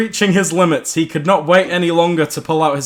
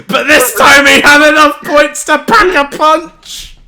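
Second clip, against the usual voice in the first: higher and louder, building hype; hyped narration; 9 semitones higher, 6 dB louder than usual. Acted. Narrator hypes the final blow, rising excitement. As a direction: sell the power-up like a trailer.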